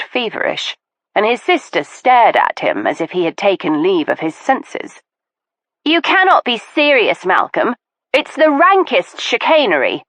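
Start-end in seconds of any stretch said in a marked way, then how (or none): 5.8-10.0 high, incredulous scorn; scornful disbelief. Acted. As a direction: dismiss him with contempt. Sister scorns brother's séance.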